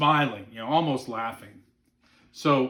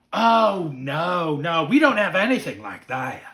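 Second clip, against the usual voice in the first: lightly voiced excited child, louder; earnest little boy; 3 semitones higher, 7 dB louder than usual. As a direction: imitate a kid's emphatic denial. Retold child answering guide.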